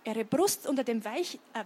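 ordinary delivery

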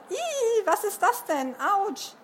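high-pitched squeal of disgust and pain; startled squeal. Acted. Jessie leaps up from an anthill, squealing. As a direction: yelp as if something just bit you. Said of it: "sprang sie wie vom Affen gebissen wieder hoch".

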